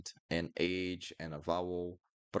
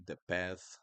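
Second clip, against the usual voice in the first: ordinary talk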